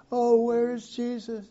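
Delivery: high, anxious searching call; worried parents. Acted. Preacher voices Mary searching for young Jesus, high and anxious. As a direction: call out for the lost child.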